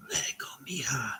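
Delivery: soft, breathy whisper of a man's voice; hushed ghostly whisper. Retold or imitated. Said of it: "Man's whispery voice".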